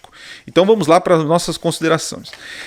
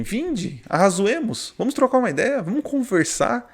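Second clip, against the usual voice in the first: lightly voiced God inviting, pitched higher; a hint of God's gentle invitation, higher; voicing God; 7 semitones higher than usual. Pastor voicing God calling people to talk, raised higher. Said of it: "Deus é um Deus que ele fala assim".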